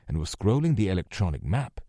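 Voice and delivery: ordinary delivery, nothing marked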